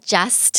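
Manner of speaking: "just" drawn out with an added tuh; over-pronounce just as jus-tuh; overdone final T. Shown as a demonstration. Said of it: "พี่ฟ้าก็ไม่พูดว่า just". (just ลากเสียงเกินเป็น จัสเทอะ)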